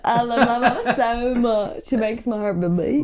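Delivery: slow, put-on Southern accent for a mama's boy; Southern American mama's boy, slowly; slow Southern American character voice; Baba Ray's Southern voice. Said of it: "Baba Ray's like that".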